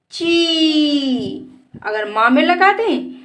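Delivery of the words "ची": ची with a long, level ee. Shown as a demonstration. Teacher reads चा plus matra for pupils, vowel held. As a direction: stretch the vowel of ची well out. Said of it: "तो इसको बोलेंगे".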